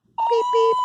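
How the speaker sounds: very high, loud engine whistle beeps. A narrator's reading. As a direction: toot like a little engine.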